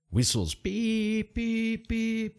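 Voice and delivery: high, slow imitated whistle beeps. Narrator imitates the city guard's whistles, high and drawn out. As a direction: pipe out the guards' whistle like a toy.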